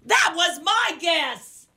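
high, drawn-out indignant outburst; stretched, high-pitched objection; loud protest. Real, not acted. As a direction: protest loudly, stretching each word high. Rival's answer matches own guess.

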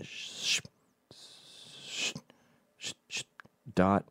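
soft unvoiced sh sounds like brush strokes; calligrapher's hushed strokes ending in dot. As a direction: breathe out each stroke.

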